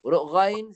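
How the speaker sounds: ghain dengan suara bergetar; voiced ghain. Shown as a demonstration. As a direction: keluarkan ghain dengan suara, tahan nafas. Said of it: "sifat dia jahar". (ghain bersuara)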